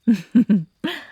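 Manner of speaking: sudden high, loud unhinged giggle; loud, high mocking laugh after a threat; creepy laugh. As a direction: let a manic laugh burst out.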